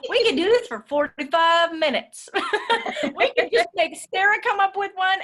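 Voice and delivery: high, loud, laughing through the words; giddy with the fun of it. Real, not acted. Laughing enthusiasm after game.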